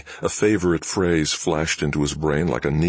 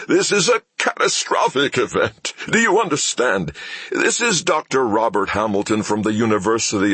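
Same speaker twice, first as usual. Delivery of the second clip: screaming into the phone; 7 semitones higher than usual. Acted. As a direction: yell it down the line in panic. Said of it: "Doctor Hamilton screamed into the phone".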